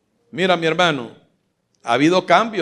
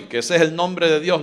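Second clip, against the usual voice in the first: normal talking voice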